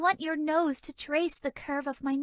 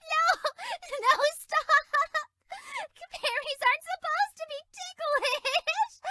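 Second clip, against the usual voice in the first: laughing protest; 10 semitones higher than usual. Acted. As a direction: beg them to stop through the giggles. Fairy being tickled.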